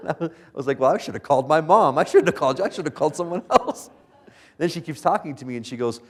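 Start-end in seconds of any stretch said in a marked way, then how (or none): 0.8-3.9 louder, higher mock protest, half laughing; exasperated retort. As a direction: throw it back at her, exasperated. Exasperated reply to his wife.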